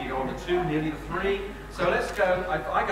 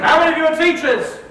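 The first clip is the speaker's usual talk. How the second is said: high, loud call to the room; rallying shout; 8 semitones higher, 9 dB louder than usual. Real, not acted. Speaker calling on teachers in the audience, shouting. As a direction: shout for a cheer.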